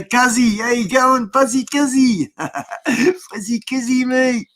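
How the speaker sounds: high, sing-song greeting; excited greeting. Real, not acted. The vlogger greets viewer Cuzzy, high and delighted.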